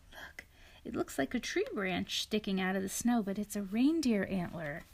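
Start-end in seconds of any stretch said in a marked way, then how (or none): none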